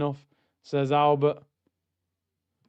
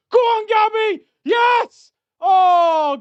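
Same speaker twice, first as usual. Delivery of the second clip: urgent shout; 5 dB louder, much slower than usual. Real, not acted. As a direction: yell high and loud, urging him on then groaning. Chance on the break live.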